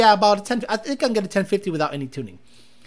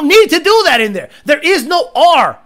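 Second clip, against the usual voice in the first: loud rant; 8 semitones higher, 8 dB louder than usual. Real, not acted. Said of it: "ranting about rolled R".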